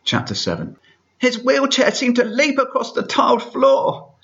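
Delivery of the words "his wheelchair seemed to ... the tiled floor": very high, slow silly voice; squeaky high reading voice; perfect reading voice. Shown as a demonstration. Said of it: "I'll stop doing the voice now".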